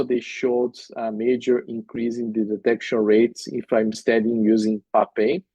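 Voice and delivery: normal talking voice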